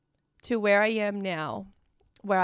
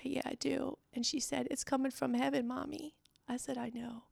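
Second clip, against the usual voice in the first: tearful; 4 semitones higher, 7 dB quieter than usual. Real, not acted. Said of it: "This is sometimes emotional to talk about".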